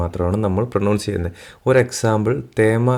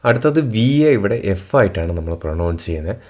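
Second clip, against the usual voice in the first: ordinary talk, nothing marked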